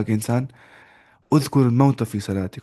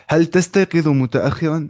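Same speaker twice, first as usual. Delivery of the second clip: higher, louder reading of the question; calling out question; 5 semitones higher, 6 dB louder than usual. Real, not acted. Teacher to students, loudly reading the exercise question. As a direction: put the question out to the class.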